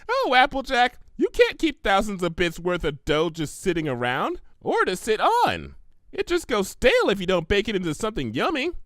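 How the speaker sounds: high, bubbly pony voice; Pinkie Pie voice. Acted. Pinkie offering Applejack the money, high and eager. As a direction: chirp it out eager and helpful.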